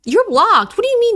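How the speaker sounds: high, loud indignant outburst; mock outrage. Blocking someone over a nap question, mock outrage. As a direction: snap it out loud and high, scandalized.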